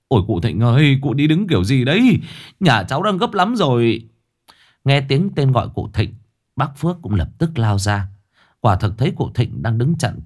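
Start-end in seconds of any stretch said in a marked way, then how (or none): 0.1-4.1 exasperated cry. A narrator's reading. Father shouting at old man blocking his motorbike, exasperated. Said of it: "Chú Hưng kêu lên".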